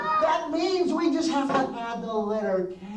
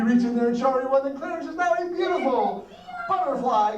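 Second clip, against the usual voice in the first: ordinary talk, nothing marked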